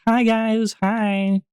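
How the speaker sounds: exaggerated high streamer greeting. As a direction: pitch it up, hamming up an old voice. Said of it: "I was doing a slightly higher voice".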